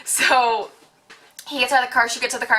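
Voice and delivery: normal talking voice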